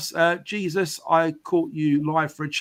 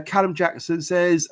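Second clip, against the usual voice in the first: ordinary talk, nothing marked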